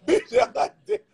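says it through high laughter; can't stop laughing while talking. Real, not acted. Cracking up at a comment.

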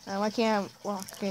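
ordinary delivery

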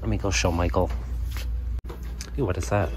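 ordinary delivery

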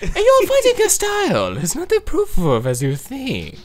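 high character voice. Pretend. Reading the sensei's challenge voiced.

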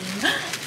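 delighted gasp. Real, not acted.

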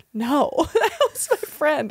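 sheepish. Retold or imitated. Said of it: "I was like".

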